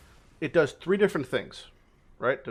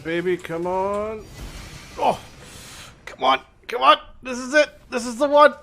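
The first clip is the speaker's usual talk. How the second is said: loud high hopeful pleading; excited pleading; 11 semitones higher, 7 dB louder than usual. Real, not acted. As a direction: plead loudly for the drop. Hoping for a good map drop.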